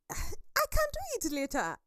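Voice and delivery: very high-pitched dismissive put-off; breezy procrastination. Acted. Speaker voicing herself putting off a task, high and dismissive. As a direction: brush the task away in a high voice.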